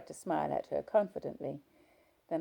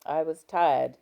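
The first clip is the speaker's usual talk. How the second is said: husband voice; 10 dB louder, slower than usual. Acted. Husband answering sharply.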